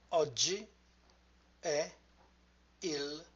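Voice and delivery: "Oggi", "è", "il" set apart with long pauses; word by word. Shown as a demonstration. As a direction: softly sound out "Oggi è il" piece by piece. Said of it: "the words into syllable".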